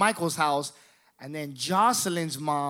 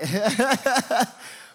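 high, rapid put-on laughing; mocking imitated laugh; 7 semitones higher, 4 dB louder than usual. Retelling how he laughed at him, high imitated laugh. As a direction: imitate someone cackling at you.

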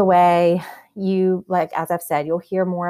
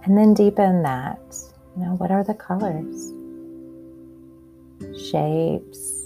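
calm grounding exercise. Acted. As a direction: guide them gently into their senses. Leads a grounding meditation.

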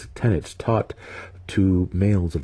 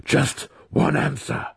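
raised, insistent demand; 7 semitones higher than usual. A narrator's reading. Wizard pressing the spirit for his enemy's name, demanding. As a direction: press for it in a higher voice. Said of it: "Alton demanded".

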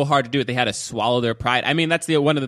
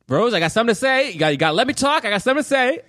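lightly acted high urgent announcement, pitch raised; voicing Troy's announcement; 11 semitones higher, faster than usual. Retelling the film, he mimics Troy telling Rose, pitched up. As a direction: mimic a man forcing out big news. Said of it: "he just goes".

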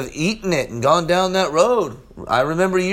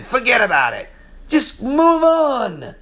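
Italian-style; 5 semitones higher than usual. Acted. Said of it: "Be like an Italian".

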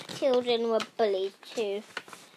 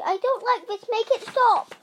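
high, raised protest; calling out; 11 semitones higher, 6 dB louder than usual. A narrator's reading. Rosie calling out against the adventure, high and urgent. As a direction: call it out higher, pleading for it to end. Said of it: "called Rosie".

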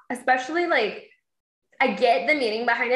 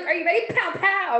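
put-on playful kid challenge; 7 semitones higher than usual. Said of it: "she was like".

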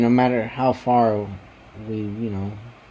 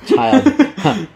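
put-on old man voice; 10 semitones higher, 6 dB louder than usual. Mock old man addressing grandchild.

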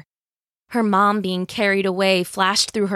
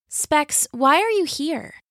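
put-on young girl's voice; 7 semitones higher, slower than usual. A narrator's reading. Jill voiced higher.

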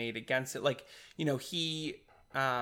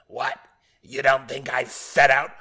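put-on loud robotic take on a New York accent; mock New York accent, punched out loud; 7 dB louder than usual. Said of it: "your robot is now becoming from New York".

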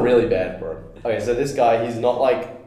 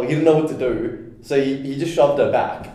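usual speaking voice

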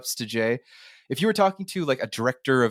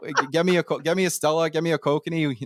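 higher-pitched, put-on Canadian hockey-fan accent; 4 semitones higher than usual. Shown as a demonstration. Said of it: "like, really put it on like that".

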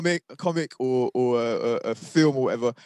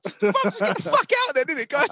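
fed up outburst; 8 semitones higher than usual. Retold or imitated. Fed up, sending friends out.

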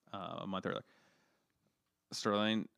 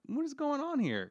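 surprised. Retold or imitated. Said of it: "and I'm like".

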